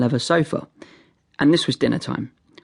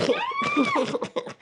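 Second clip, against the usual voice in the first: laugh; 7 dB quieter than usual. Real, not acted. Laugh after dog joke.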